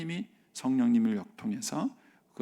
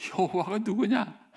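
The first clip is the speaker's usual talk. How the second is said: defiant Pharaoh; 7 semitones higher, 5 dB louder, slower than usual. Acted. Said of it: "바로가 이르되".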